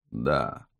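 faint low croak; weak dying voice. Acted. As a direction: answer like a dying man. Dying witness answers questions. (слабый голос умирающего)

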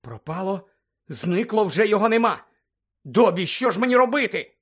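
Harry panicking to Dobby; frightened plea. Acted. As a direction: beg the elf for help. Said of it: "перелякано дивлячись на ельфа".

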